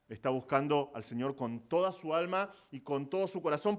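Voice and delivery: usual speaking voice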